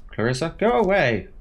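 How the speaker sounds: loud, high command; sharp shout. Acted. Veronica shouting at Clarissa to leave. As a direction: snap the order out.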